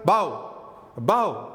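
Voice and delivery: "बाव" quick, first vowel dropped; "बाव" said as one short syllable; reduced above. Shown as a demonstration. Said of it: "तो आपको सुनाई क्या देगा". ("बाव" जैसा सुनाई देता उच्चारण)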